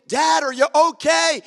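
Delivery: high, loud mock calls for Dad; retold yelling. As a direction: call out for Dad across the garden. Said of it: "He was yelling".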